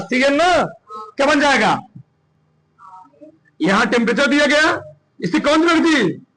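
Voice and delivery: ordinary delivery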